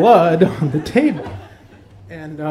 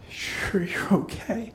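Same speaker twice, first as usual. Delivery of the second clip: slow, softer question; hushed concern; 7 dB quieter, slower than usual. Retold or imitated. Dad looking at his hurt son, slow and hushed. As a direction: check gently, drawing the words out.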